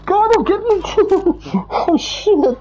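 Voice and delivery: very high, drawn-out squeal with laughter; high frightened laughing plea; nervous panic. Real, not acted. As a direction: squeal through scared giggles. Scared, killer nearby.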